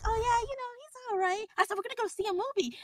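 high, fast chatter; high excited chatter about husband; chatty oversharing. Retold or imitated. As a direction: rattle on happily, too much. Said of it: "I'm like".

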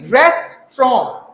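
रेस्टोरेंट as slow "res-trom", no t; silent final T. Shown as a demonstration. Teacher explaining the silent letter, रेस्टोरेंट ending without t. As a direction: drop the last t of रेस्टोरेंट, two slow beats. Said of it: "पिछला टी है ही नहीं है".

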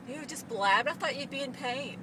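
ordinary talk, nothing marked

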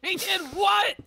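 very high, squeaky disbelief; shocked shriek. Real, not acted. Shocked by dogs' killing.

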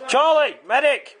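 urgent high yell for the medic; man down shout. Real, not acted. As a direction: call for the medic at the top of your lungs. Hit in game, calling medic.

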